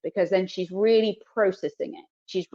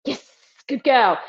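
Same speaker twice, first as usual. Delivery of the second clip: warm praise; 4 semitones higher, 5 dB louder, slower than usual. Real, not acted. Trainer praising her dog with a slow, loud good girl. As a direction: praise her like she nailed it.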